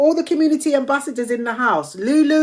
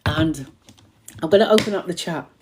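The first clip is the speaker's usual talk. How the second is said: ordinary talk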